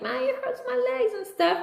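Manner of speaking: put-on high, thin kid voice complaining; small boy in pain, lightly voiced; a hint of a hurting child; imitated pained child. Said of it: "he's like".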